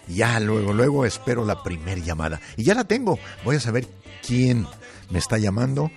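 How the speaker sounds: ordinary talk, nothing marked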